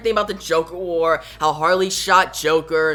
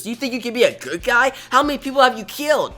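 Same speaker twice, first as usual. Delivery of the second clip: higher, fast, pressing questions; mock accusing; 6 semitones higher, faster than usual. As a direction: fire the questions at her. Bao challenging Harley.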